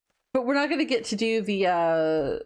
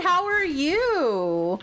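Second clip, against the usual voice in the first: sing-song; 6 semitones higher, much slower than usual. Real, not acted. Playful greeting to chat.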